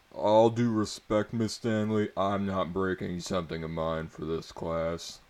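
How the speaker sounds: loud indignant protest; objecting. A narrator's reading. Student objecting loudly to the teacher. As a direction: push back loudly at the teacher.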